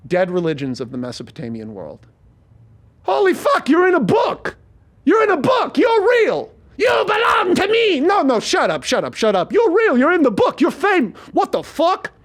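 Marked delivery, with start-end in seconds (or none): 3.0-6.5 high-pitched frantic shout; shocked yelling. Acted. Acted hero's shocked discovery. 6.8-8.0 high monstrous scream; rotten demon woman. Acted. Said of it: "hearing this voice and seeing this rotten woman". 8.0-12.1 high-pitched panicked outburst; frantic arguing. Acted. Hero argues back at the apparition.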